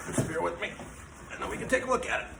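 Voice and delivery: straining while lifting; strained words under heavy effort; grunting. Real, not acted. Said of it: "Forgive the grunting".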